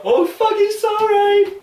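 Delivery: very high, loud comic squawk; mock a delighted viewer, shrieking high; put-on high-pitched cheer; imagined viewer's outburst. Voices Canadians watching and reacting.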